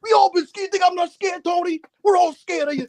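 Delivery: high, loud imitation of a tough guy; hammed-up plea to rally Tony; mock dramatic pep talk. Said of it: "he's like".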